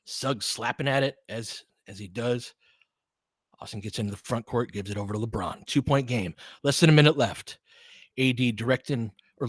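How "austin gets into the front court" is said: normal talking voice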